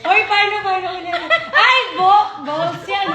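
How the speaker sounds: loud laughing outburst. Real, not acted. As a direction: shout it through laughter.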